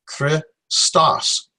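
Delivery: "Christos" split into kri, pause, STOS; kri... stos, two separate syllables of "Christos". Shown as a demonstration. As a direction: break "Christos" before the st. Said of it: "where you divide it".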